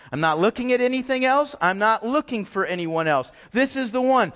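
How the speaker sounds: normal talking voice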